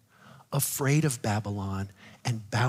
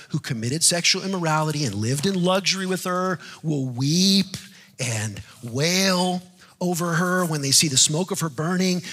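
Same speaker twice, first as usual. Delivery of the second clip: rising, louder preaching read of the verses; scripture reading; 5 semitones higher, 6 dB louder than usual. Acted. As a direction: proclaim the lament of the kings. Reads Revelation at rising force.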